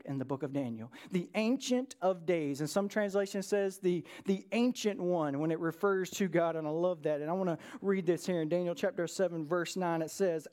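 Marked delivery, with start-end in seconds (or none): none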